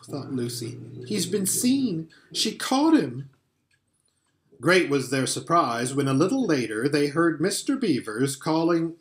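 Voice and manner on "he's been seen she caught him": frightened. Acted. Frightened Lucy fears the worst.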